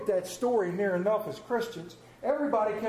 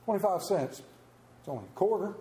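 ordinary talk, nothing marked

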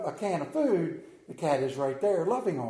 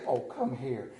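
lower, softer call to the dog; imitating wife's coaxing; 5 semitones lower, 6 dB quieter than usual. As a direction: coax the dog over like his wife. Wife calling the dog, imitated.

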